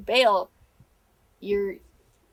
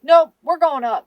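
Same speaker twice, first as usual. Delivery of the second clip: higher, louder flat no; firm refusal; 6 semitones higher, 10 dB louder than usual. Retold or imitated. To his partner who wants to go down, a firm loud refusal. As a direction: shut the question down. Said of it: "He's like".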